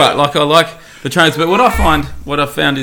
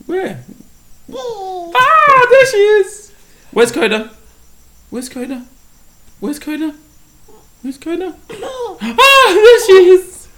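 high, excited peekaboo voice; 4 dB louder, slower than usual. Real, not acted. Playing peekaboo with baby.

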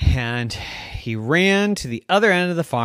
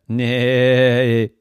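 goat's nay; 2 semitones lower, 5 dB louder than usual. Acted. Goat answers.

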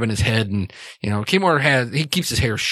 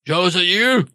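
hurt dwarf calling out; 7 semitones higher than usual. Acted. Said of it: "he kinda goes".

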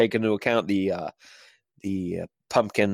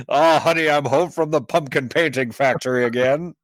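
1950s housewife; 5 semitones higher, 3 dB louder than usual. Pretend. Mock sitcom homecoming skit.

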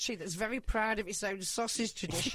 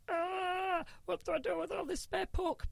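high mock panic over spare pork; mock exasperation; 11 semitones higher than usual. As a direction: play the overwhelmed butcher. Acted own panic.

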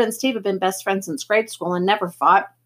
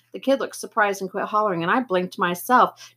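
ordinary delivery, nothing marked

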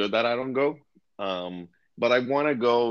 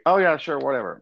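high-pitched imitation of a careless brush-off; mock indifference; 8 semitones higher, 4 dB louder than usual. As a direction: toss it off as if you don't care, pitched up. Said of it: "you don't care".

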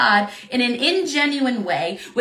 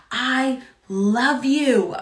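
slow, tender I love you; slow declaration; much slower than usual. Acted. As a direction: let each word land slowly. Repeated declaration, first spoken.

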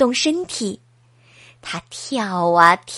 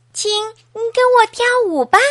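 eager plea; 9 semitones higher, 3 dB louder, faster than usual. Acted. Hedgehog begs butterflies to dance.

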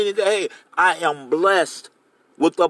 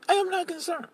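high but softer, I am not concerned let go quietly; David settling it, I am not concerned said softer; softer repeat; 6 dB quieter than usual. Retold or imitated. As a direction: drop I am not concerned back down to a hush. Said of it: "David's like".

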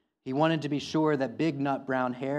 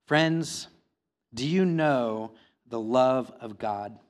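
preaching at full force; 6 dB louder than usual. Acted. Pastor urging the congregation to know God's love, preaching loudly. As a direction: preach it out to the whole room, urgent and pleading.

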